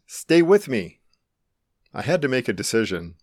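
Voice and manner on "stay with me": higher, urgent raised voice; narrated shout, pitched up; shouted plea. A narrator's reading. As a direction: call out to keep someone conscious. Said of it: "he shouted again".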